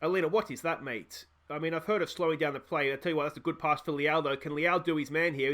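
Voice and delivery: ordinary delivery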